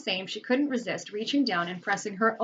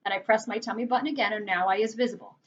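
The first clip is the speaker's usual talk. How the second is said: plain reading voice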